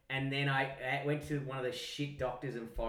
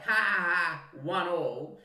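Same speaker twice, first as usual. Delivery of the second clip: mock victory laugh, loud; gloating taunt; 3 semitones higher, 5 dB louder, slower than usual. Brother taunting the one he just pushed in, gloating. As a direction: crow over evening the score.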